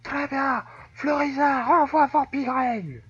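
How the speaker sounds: high-pitched character battle call; invented trainer voice. Acted. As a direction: call the command in a put-on high voice. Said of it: "J'invente les voix au perso".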